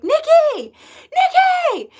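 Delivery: high, loud call down the street; calling out a name. Retold or imitated. Stranger calling out to her down the street, high loud call. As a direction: shout her name to catch her attention.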